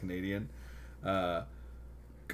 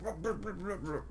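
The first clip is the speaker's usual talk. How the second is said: mock grumbling babble; 10 semitones higher, 5 dB quieter, faster than usual. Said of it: "the guy was just like".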